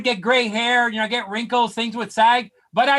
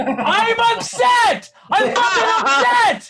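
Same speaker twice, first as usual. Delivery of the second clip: higher, slow hammed-up shout; mock upset shout; 8 semitones higher, much slower than usual. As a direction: yell to wife that you really are upset. Angry reply to wife.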